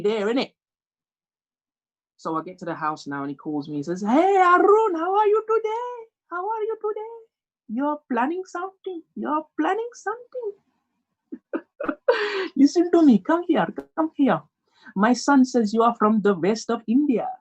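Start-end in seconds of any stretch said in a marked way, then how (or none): 4.0-10.5 very high sing-song Indian dad; hammed-up Indian accent, voice pitched way up. 11.3-12.5 high-pitched teasing dad laugh; high chuckle. Acted. 12.6-17.3 high, insistent Indian dad; hammed-up Indian accent, pitched up.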